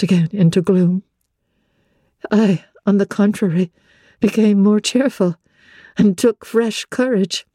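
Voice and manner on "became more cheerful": ordinary delivery, nothing marked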